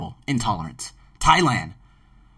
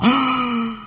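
big audible intake of breath; sharp gasp; 9 semitones higher than usual. Real, not acted. Host after gushing about gratitude, big gasping breath. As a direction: gasp in air after a long run.

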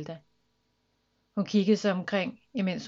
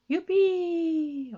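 long high whoop; gleeful drawn-out cry; happy shout; 10 semitones higher, 4 dB louder than usual. A narrator's reading. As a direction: cheer with delight, holding the vowel. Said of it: "råbte hun glad".

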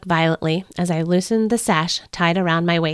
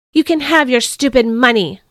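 higher, louder angry outburst; moderately raised, bitter shout; 5 semitones higher, 5 dB louder than usual. A narrator's reading. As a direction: fling it at them in anger. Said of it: "I shouted".

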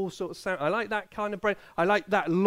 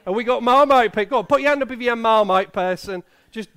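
usual speaking voice

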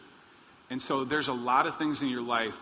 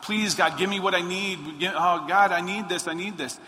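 higher, louder pleading, half-mocking the asker; mock begging prayer; 5 semitones higher, 4 dB louder than usual. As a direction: play the whiny begger at God. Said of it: "we're begging and pleading God".

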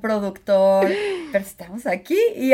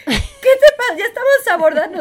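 much higher, louder, laughing; mock exasperated yell; 9 semitones higher, 10 dB louder than usual. Woman retelling scolding her partner at boarding, laughing yell.

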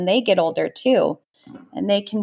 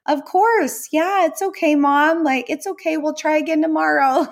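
put-on much higher, sweet little boy voice; imitating her son; 8 semitones higher than usual. Son reassuring his mom after she yelled, high boyish voice. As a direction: play the kind young son comforting mom. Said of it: "my son ... will be like".

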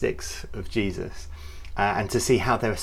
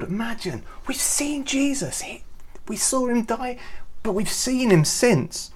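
amazed; 12 semitones higher than usual. Pretend. Disciples amazed.

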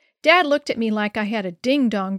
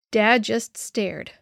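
ordinary delivery, nothing marked

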